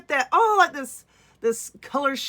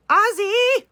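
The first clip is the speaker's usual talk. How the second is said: excited cheer; 10 semitones higher, 4 dB louder than usual. Real, not acted. Cheers the [name] theme.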